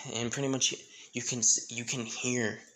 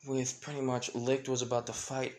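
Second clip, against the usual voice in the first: ordinary delivery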